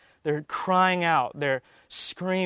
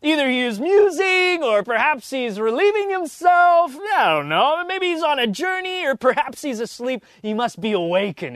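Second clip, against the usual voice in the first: much higher, louder sarcastic taunting; mocking Elijah; 10 semitones higher, 8 dB louder than usual. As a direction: jeer at them, drip with scorn. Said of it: "Elijah mocked them".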